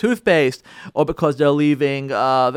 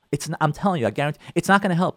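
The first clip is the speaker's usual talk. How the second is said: ordinary talk, nothing marked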